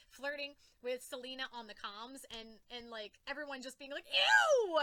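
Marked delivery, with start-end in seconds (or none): 4.0-4.8 high, loud grossed-out squeal; disgusted shriek.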